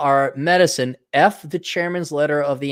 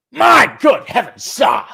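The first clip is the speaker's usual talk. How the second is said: loud, high-pitched condescending British gent; hammed-up British snootiness; mock condescension; 6 semitones higher, 4 dB louder than usual. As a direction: play a condescending Brit, voice jumping up loud. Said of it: "The, the, the condescension".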